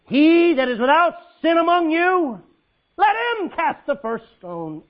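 loud, high commanding pronouncement, slow at the end; powerful proclamation. Acted. Jesus commanding the accusers with the voice of Moses, loud and forceful. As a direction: proclaim it like thunder from Sinai. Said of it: "with the power of an earthquake".

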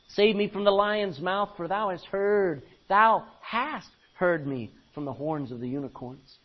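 loud, declaiming King James reading; scripture reading. Acted. Preacher reads Lamentations to congregation, declaiming. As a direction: proclaim the verse to the hall.

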